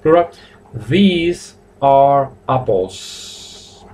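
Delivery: very slow, word-by-word dictation; drawn-out teaching pace, evenly spaced; slow model sentence. Shown as a demonstration. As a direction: stretch each word out for learners.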